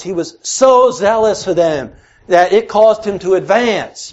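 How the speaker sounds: high, loud, fervent preaching of Paul's words; preaching at full force. Acted. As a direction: drive the scripture home, pulpit-strong.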